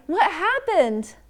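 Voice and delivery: high, slow, stretched-out storybook cry; alarmed cry. A narrator's reading. Mama after Baby's fall, crying out. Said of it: "Mama cried".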